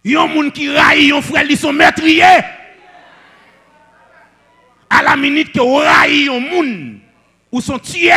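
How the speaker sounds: high, shouted proclamation; full-force preaching. Real, not acted. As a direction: thunder the apostle's warning at the congregation. Preacher proclaims apostle John's warning. (rèl predikatè)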